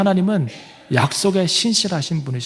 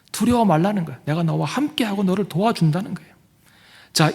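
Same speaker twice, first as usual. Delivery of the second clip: ordinary talk, nothing marked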